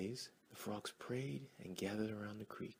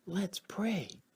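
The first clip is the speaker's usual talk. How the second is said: higher, excited little cry; eager frog call; 10 semitones higher, 7 dB louder than usual. Acted. Frogs in the crowd call out to pray, eager and high. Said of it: "Others began shouting".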